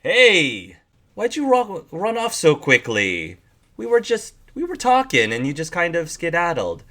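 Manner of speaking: lightly voiced stranger, pitch jumping high; pushy lizard man. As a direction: casually needle her for running off.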